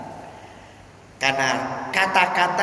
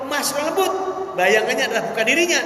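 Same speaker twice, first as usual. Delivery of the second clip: normal talking voice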